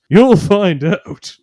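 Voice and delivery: You'll find out, drawn out and booming. Retold or imitated. Paranoid caveman repeats warning.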